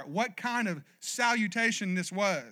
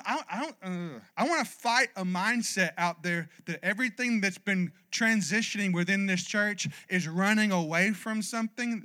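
ordinary talk

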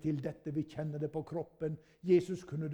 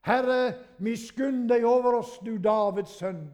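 high, loud pleading shout; crying out even louder; 7 semitones higher, 11 dB louder than usual. Acted. Blind men shouting past the scolding crowd, loud desperate plea. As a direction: call out over the crowd, begging. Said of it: "men de ropte enda mer".

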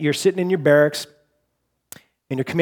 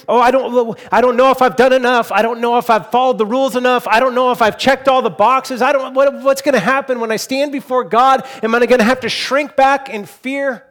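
put-on high, loud, anxious voice of a fretting child; a worried child pleading, pitched up high and louder; fretful voice of a believer afraid of facing God; 8 semitones higher, 6 dB louder than usual. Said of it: "He doesn't want them to be worried".

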